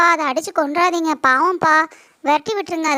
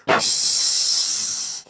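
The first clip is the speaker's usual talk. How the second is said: snake hiss; much slower than usual. Acted.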